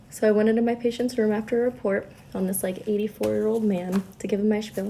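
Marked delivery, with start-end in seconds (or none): none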